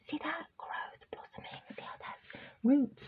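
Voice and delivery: put-on whispered cooing baby talk. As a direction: coo softly like to a puppy. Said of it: "I gave my baby voice".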